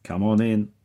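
loud, muffled voice through a speaker; muffled intercom voice. A narrator's reading. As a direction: sound as if heard through an intercom grill. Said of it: "says the still muffled voice".

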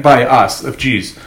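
ordinary talk

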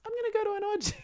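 sudden high, sing-song voice; high-pitched punchline. Retold or imitated. Punchline of her inner monologue.

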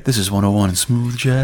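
smooth jazz DJ intro; radio voice. Pretend. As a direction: play a late-night radio DJ. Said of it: "give my radio voice".

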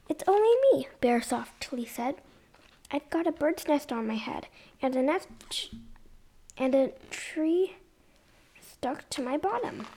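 lively sing-song read-aloud; storybook reading. Acted. Mum reading a picture book to kids, lively. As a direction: read it like bedtime story time.

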